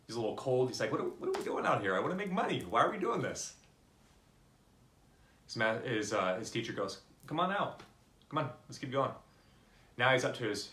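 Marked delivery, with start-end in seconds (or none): 0.9-3.5 put-on high-pitched, louder kid's protest; acted-out fed-up kid; exasperated complaint. Cold kid protests to mentor. 7.3-9.1 higher-pitched urging; acted-out mentor egging a kid on; coaxing call. Mentor coaxes kid into deeper water.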